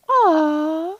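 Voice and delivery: cooing. Retold or imitated. Boy imitated cooing at puppy.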